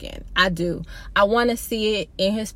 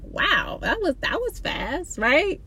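high, softer, sarcastic wow; higher mock surprise, quoting herself; mock amazement; 7 semitones higher, 3 dB quieter than usual. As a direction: act amazed, teasing. Mock surprise at husband's call.

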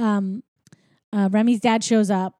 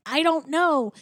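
higher-pitched mock helplessness; mildly flustered; 5 semitones higher, slower than usual. Linguini answering the kitchen staff, mildly flustered mock panic. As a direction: plead it with a touch of panic. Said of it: "Linguini's freaking out".

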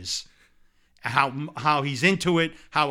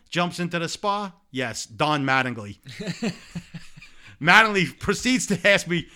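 ordinary talk

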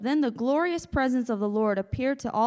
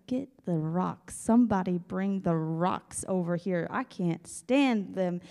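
grumbling mob; 3 semitones lower than usual. Acted. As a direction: growl for someone to fetch rocks. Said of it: "these guys are just like".

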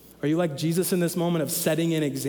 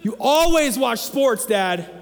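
put-on high, loud, slow toddler whine; whiny toddler; 8 semitones higher, 6 dB louder, much slower than usual. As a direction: drag it out like a complaining two-year-old. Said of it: "he does this in his terrible twos".

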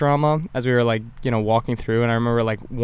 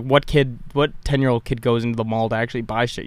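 usual speaking voice

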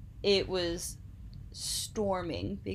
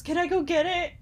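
pleading friend; 9 semitones higher, 6 dB louder than usual. Retold or imitated. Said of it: "And he goes".

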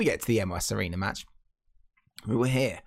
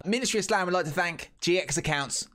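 bright, higher sponsor pitch; ad read; 5 semitones higher than usual. Acted.

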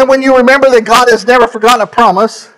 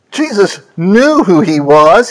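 ordinary talk

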